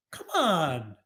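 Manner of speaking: normal talking voice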